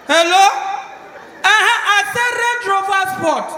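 loud, pressing voice over a bad network; loud phone call. Rich man shouting into a bad phone line.

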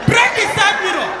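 sharp loud bark of an order; loud shout. Retold or imitated. Girl shouting at her man to break the mirror. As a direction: yell the command.

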